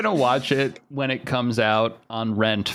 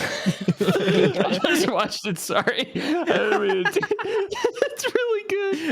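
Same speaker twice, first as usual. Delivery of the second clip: laughing; 14 semitones higher, slower than usual. Real, not acted. Laughing after the clip.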